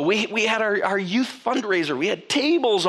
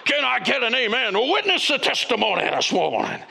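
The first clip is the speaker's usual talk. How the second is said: mock televangelist; 3 semitones higher than usual. Mocking prosperity preacher.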